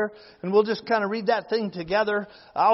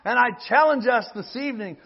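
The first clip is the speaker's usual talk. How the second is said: ordinary talk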